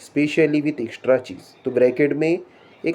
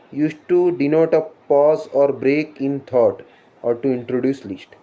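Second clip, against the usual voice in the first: slow dictation; much slower than usual. Shown as a demonstration. Reading a definition off the slide.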